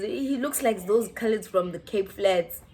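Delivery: usual speaking voice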